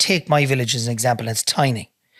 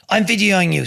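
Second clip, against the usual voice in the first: put-on English accent, pitched higher; imitated English accent; 6 semitones higher than usual. The stranger threatening to film, in a higher English accent. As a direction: mimic the man's English accent, voice raised in pitch. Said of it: "he's an English accent".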